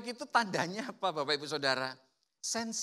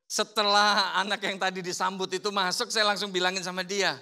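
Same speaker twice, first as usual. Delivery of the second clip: ordinary talk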